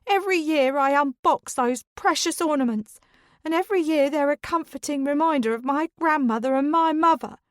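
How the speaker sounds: high, loud, tearful shout; distraught shouting grandmother's heir. A narrator's reading. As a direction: cry out the loss at full voice. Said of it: "and is shouting".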